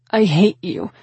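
loud, tearful outburst; shouted. A narrator's reading. Woman shoving the man, shouting. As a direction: raise the voice in hurt fury. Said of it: "I shouted".

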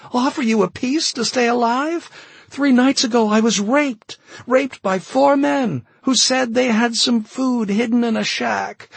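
a voice cracking towards hysteria; near hysteria. A narrator's reading. Carol confessing to the colonel, near hysterical. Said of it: "her voice rising to near hysteria".

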